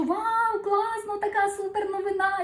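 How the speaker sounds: high, bubbly cries of joy; bursting with excitement. Retold or imitated. Sharing her success with friends, excited and high. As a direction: gush over the good news, full of drive. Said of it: "бабабах з енергією, з драйвом".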